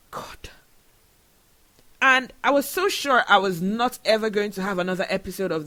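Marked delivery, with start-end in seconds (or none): 0.1-0.5 breathy whispered God. Real, not acted. Overwhelmed host whispering to herself, exhausted whisper. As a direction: breathe it out, barely voiced. Whispered sigh after emotional moment.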